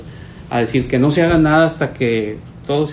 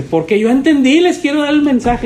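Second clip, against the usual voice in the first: high, louder mock eagerness; eager insistence; 10 semitones higher, 4 dB louder than usual. Pastor voicing the caller who wants to give the message, high and eager.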